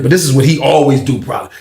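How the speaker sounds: ordinary talk, nothing marked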